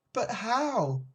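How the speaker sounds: high-pitched piglet question; doubtful little pig. A narrator's reading. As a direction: ask uncertainly as the little pig.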